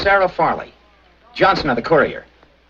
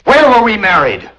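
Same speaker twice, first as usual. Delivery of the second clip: shouting; 5 semitones higher, 5 dB louder than usual. Acted. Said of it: "Don't shout".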